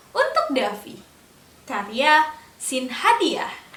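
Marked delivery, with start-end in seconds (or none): none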